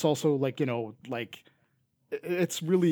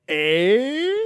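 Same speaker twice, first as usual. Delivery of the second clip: high, loud, drawn-out rising "Eh?"; hammed-up catchphrase, swooping sharply upward; show catchphrase voice; 8 semitones higher, 9 dB louder than usual. Shown as a demonstration. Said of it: "we'll have show voices".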